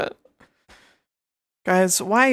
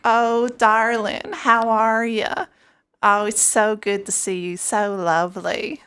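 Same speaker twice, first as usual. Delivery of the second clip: slow, high, louder southern drawl; southern accent; 3 semitones higher, 4 dB louder, slower than usual. Retold or imitated. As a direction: greet a darling in a southern accent. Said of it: "talk a little bit like this".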